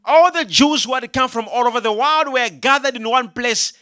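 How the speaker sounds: normal talking voice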